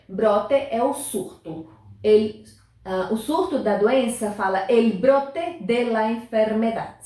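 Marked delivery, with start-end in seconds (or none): none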